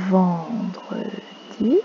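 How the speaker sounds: "Vendredi" stretched very slowly, every syllable held; drawn out. Shown as a demonstration. Teacher modelling "Vendredi" slowly for the class. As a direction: draw out "Vendredi" so the children hear it. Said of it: "repeats day for the class".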